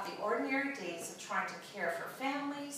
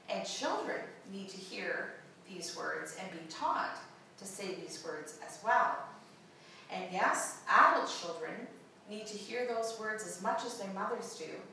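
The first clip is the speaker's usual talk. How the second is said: normal talking voice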